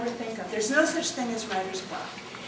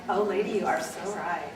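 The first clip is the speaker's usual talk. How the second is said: fawning agreement; 5 semitones lower, 3 dB quieter than usual. Retold or imitated. Audience agreeing, acted.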